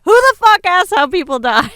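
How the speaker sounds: high, loud outraged retort; angry yell. Retold or imitated. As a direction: yell it in disbelief. Retelling her angry retort.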